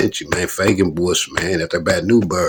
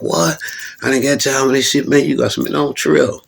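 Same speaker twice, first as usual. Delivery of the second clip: mild mock annoyance; 7 semitones higher than usual. Teron mad, quoted.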